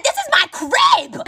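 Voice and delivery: very high, drawn-out shriek of outrage; outraged yell. Retold or imitated. Emily to the friends in her own house, outraged yell. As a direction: stretch it into an indignant high shout.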